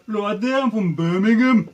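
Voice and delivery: put-on Birmingham accent, belted out. Shown as a demonstration. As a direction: demonstrate a hammed-up Birmingham voice. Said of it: "I demonstrate my Birmingham one".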